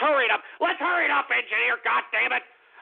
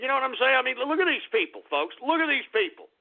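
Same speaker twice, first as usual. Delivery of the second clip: normal talking voice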